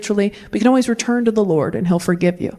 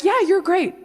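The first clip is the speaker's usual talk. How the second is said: doting dad; 9 semitones higher, slower than usual. Retold or imitated. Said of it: "And the dad is like".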